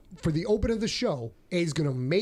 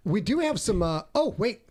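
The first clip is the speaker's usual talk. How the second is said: usual speaking voice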